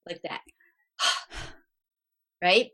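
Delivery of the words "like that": breathy demo exhale; quick loud exhalation. Shown as a demonstration. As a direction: demo sigh, air pushed out fast. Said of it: "a quick, loud exhalation".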